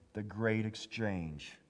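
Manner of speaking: ordinary delivery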